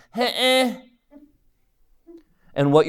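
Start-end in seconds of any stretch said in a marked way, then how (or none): 0.1-0.8 high, petulant "Heh, eh" huff; whiny huff. Retold or imitated. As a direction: huff like a wronged kid. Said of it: "is a fancy way of saying".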